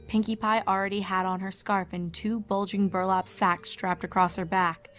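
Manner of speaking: ordinary delivery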